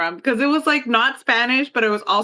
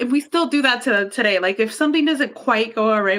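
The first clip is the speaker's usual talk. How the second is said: usual speaking voice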